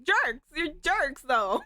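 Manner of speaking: slow, teasing name-calling; playful mock insult. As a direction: tease them with a fake scold.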